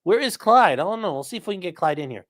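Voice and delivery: ordinary delivery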